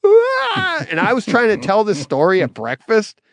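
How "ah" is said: high sob-like wail, much higher; crying wail. Retold or imitated. Retelling breaking down at breakfast: high crying wail. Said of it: "And the".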